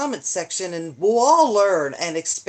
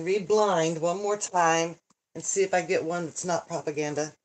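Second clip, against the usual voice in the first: ordinary talk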